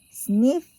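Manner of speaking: loud sniffing sound. Acted. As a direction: sniff the air loudly. Badger sniffs in story.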